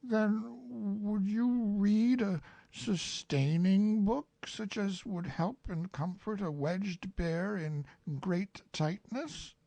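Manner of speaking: high, slow, pleading bear voice; tearful Pooh. Acted. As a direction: ask humbly, near tears. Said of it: "a tear rolled down his eye".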